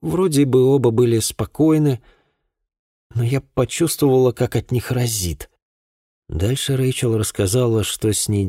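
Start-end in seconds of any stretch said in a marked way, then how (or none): 0.0-5.5 put-on higher-pitched voice for a girl recounting events; distressed girl witness. A narrator's reading. Girl recounts that night to an investigator, tense. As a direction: tell it as a troubled young woman, pitched up.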